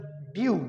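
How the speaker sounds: "ड्यू" repeated as dyoo, like D-E-W; "ड्यू" with a y-glide, dyoo; British "ड्यू", dyoo; "do" as dew. Shown as a demonstration. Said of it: "D E W".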